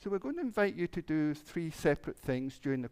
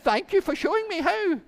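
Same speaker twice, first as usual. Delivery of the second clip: excited thanks; 11 dB louder than usual. Acted. Rooster thanks the child.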